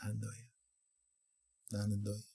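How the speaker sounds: very soft, intimate assurance; whisper-close, as God to one person. Acted. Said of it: "하나님 말씀하시는 거예요".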